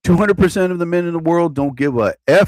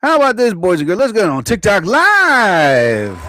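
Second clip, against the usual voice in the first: announcer voice; 5 semitones higher, 3 dB louder than usual. Acted. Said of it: "I should do it like this".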